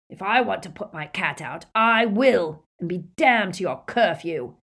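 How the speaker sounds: indignant barman, rising to loud; heated, stubborn retort; angry defiance. A narrator's reading. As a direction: push back hard against their rules. Barman defies Death Eaters in quarrel.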